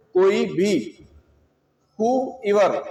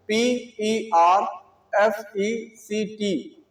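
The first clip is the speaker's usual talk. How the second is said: slow spelling; much slower than usual. Shown as a demonstration. Teacher slowly spelling perfect for students. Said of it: "spelling perfect slowly".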